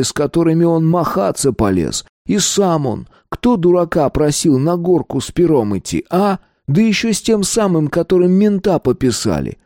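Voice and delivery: high-pitched, mounting outrage; nearly genuine indignation. A narrator's reading. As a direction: work yourself up into indignant protest. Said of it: "загораясь почти настоящим негодованием".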